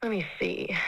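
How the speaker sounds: normal talking voice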